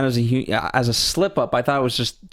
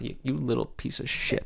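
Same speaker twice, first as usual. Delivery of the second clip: much softer muttered insult; under her breath; 9 dB quieter than usual. Retold or imitated. Mother insulting her son under her breath, much softer. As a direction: mutter it so nobody hears. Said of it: "under her breath".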